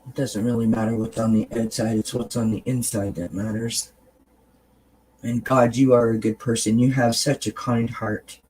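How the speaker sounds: tender reassurance to a tired friend in the mirror; kind mirror talk. Shown as a demonstration. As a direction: talk to yourself like your best friend. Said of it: "And you say this".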